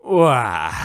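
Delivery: relieved cartoon groan; contented groan. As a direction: let out a happy Wah. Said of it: "he groaned contentedly".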